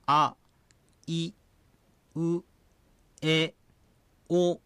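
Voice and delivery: あ、い、う、え、お each spoken alone, with a pause between; Ainu vowels, slow and clear. Shown as a demonstration. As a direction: say あ、い、う、え、お one at a time, slowly. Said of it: "母音は、". (母音をひとつずつはっきり発音)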